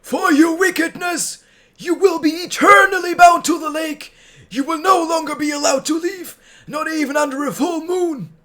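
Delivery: furious king. Acted. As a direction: pronounce judgment in a rage. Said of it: "his furious voice could be heard".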